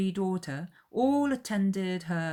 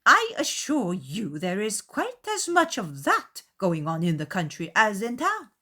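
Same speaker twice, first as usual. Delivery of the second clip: offended mother; 5 semitones higher, 5 dB louder than usual. A narrator's reading. Mother retorting to Darcy, a touch indignant. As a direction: put him in his place. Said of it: "offended by his manner".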